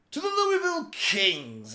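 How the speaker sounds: high, slow, loud announcer intro. Real, not acted. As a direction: call it out like a hype announcer. Announcing the next team.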